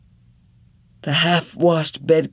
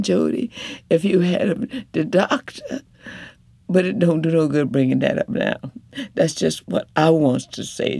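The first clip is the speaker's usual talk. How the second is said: higher, earnest, fast in places; reproaching wife; 4 semitones higher than usual. Acted. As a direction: make him finally hear her.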